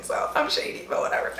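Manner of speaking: ordinary talk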